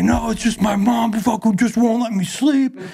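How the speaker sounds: high, whiny complaining voice far above his own; whiny addict son. Retold or imitated. To a friend, mocking the using kid's excuse, high whiny whine. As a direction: whine it out like a strung-out kid dodging blame. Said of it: "He's like".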